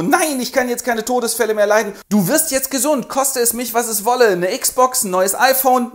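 high, loud pleading mother. Acted. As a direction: plead like a worried mom.

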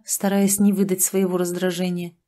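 usual speaking voice

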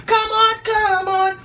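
high, slow come on; excited hype. Real, not acted. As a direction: pump up the crowd. Hyping herself, high slow.

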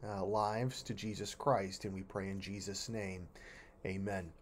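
low, soft prayer voice; closing prayer. Real, not acted. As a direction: bring the prayer gently to a close, low and quiet. Closes a spoken prayer.

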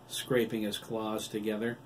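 ordinary delivery, nothing marked

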